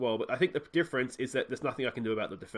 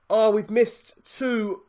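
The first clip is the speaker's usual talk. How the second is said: anguished groan; 7 semitones higher, 7 dB louder, much slower than usual. Real, not acted. Despair after misses.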